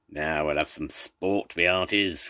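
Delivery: ordinary delivery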